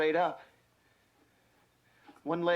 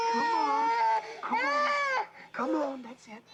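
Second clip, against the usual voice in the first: excited urging; slower than usual. Real, not acted. Rescuer pulling the baby free, excited.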